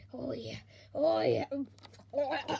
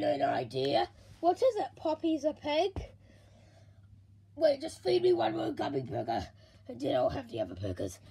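ordinary talk, nothing marked

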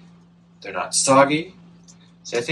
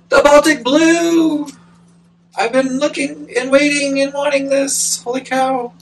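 thrilled squeal; 13 semitones higher, 4 dB louder than usual. Real, not acted. Plant lover finding a long-wanted Baltic Blue, thrilled squeal. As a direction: squeal over a long-awaited find.

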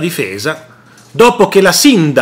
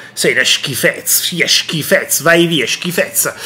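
condescending scorn; 4 dB quieter than usual. Retold or imitated. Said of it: "ti guardano dall'alto in basso e ti dicono".

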